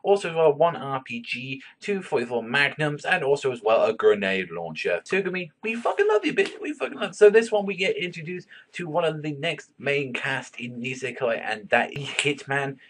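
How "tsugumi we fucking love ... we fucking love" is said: excited gushing. Real, not acted. Gushing over favourite character.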